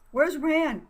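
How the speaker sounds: sudden high urgent question; exclaiming. Acted. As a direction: blurt it out, alarmed. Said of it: "Kelly exclaimed".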